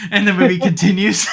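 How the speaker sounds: laughing. Real, not acted. Laughs retelling Mirren joke.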